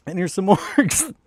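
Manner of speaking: high, slow, breaking into a laugh. Real, not acted. Streamer returning to orcs for chat, laughing. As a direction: laugh through the words.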